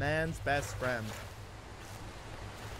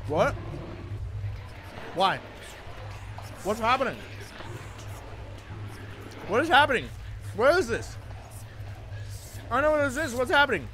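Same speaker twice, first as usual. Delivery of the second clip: high, puzzled questioning; confused; 5 semitones higher, much faster than usual. Real, not acted. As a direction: ask it bewildered. Confused by sudden change.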